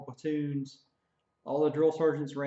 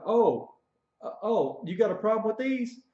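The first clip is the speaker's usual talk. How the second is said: higher, louder mock challenge; taunting drill sergeant; 8 semitones higher, 5 dB louder than usual. Drill sergeant sneering at the recruit, higher and louder. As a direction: puff up like an offended drill sergeant.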